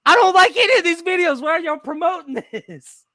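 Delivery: loud, high comic complaint; hammed-up outrage, easing at the end; exasperated protest. Acting out exasperation at the feed.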